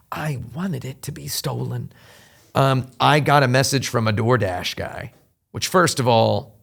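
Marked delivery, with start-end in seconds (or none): 0.1-1.9 hushed whisper, like a secret aside; mock-secret whisper; whispered confession.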